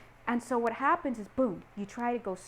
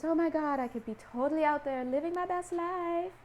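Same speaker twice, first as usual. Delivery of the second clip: higher, dreamy acted enthusiasm; mock excitement; 5 semitones higher than usual. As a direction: play someone carried away by a fantasy. Acts out the muse's temptation.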